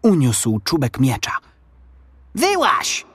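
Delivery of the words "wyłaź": high-pitched challenging call; shouted order, pitched high without much volume. A narrator's reading. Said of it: "zawołał".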